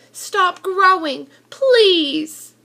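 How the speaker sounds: begging the fish to stop; pleading. Acted. Boy begging his growing fish, pleading. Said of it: "I said".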